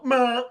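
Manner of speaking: loud imitated senseless noise; mock dazed groan. Alice released from tube, mock confused grunt. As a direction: blurt a wordless dazed bleat.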